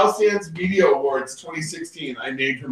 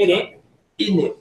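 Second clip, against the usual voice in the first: higher Cockney "innit", repeated; 4 semitones higher, 3 dB louder than usual. Retold or imitated. Passenger imitating his London cabbie, Cockney accent. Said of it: "It's like the Cockney".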